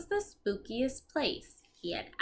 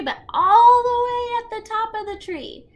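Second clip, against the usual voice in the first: excited emphasis; 12 semitones higher, 10 dB louder than usual. Real, not acted. Teacher explaining to children, loud excited emphasis. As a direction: make the height sound amazing.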